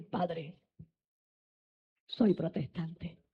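soft, slow admission to an angry father; almost under the breath; quiet confession. Retold or imitated. Hijo confiesa al padre enojado. (confesión lenta y en voz baja)

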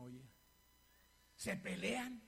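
ordinary delivery

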